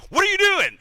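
high, loud exasperated outburst; frustrated. Acted. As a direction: yelp it at a useless tennis partner. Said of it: "he's getting frustrated".

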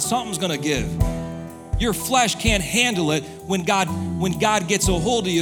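normal talking voice

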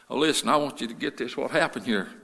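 normal talking voice

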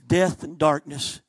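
usual speaking voice

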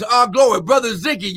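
ordinary talk, nothing marked

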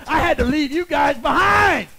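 retold reply, loud and forceful; emphatic shout. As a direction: belt out the justification like a preacher. Acted forceful answer to friend.

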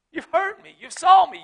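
ordinary delivery

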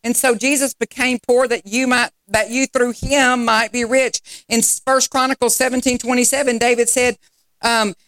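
rising sermon call-and-response; fervent sermon delivery; preaching. Acted. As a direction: preach it to the congregation. Preaching to congregation.

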